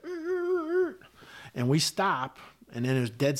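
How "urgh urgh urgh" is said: high-pitched squealing Humvee brakes; imitated brake screech. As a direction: screech like skidding tyres, held high. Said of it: "the Humvee's like".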